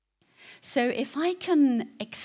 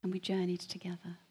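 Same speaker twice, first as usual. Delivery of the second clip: hushed whisper; 6 dB quieter than usual. Real, not acted. Recalls the voice that walked with him.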